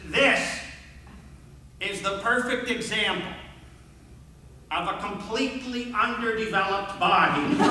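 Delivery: distinctive coach voice. Retold or imitated. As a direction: lightly mimic a teacher presenting an exhibit. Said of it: "in that very distinctive voice".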